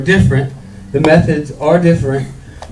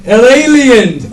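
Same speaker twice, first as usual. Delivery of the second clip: shouted title; 8 semitones higher, 7 dB louder, slower than usual. Acted. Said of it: "I'm gonna do tonight's skit".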